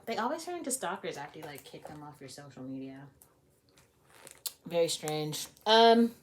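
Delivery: plain reading voice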